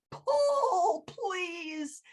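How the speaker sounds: very high, strained pull, then a faint please; wounded tree begging for help; pleading tree. Acted. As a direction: beg in pain. Tree begs.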